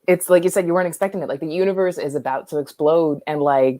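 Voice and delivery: ordinary delivery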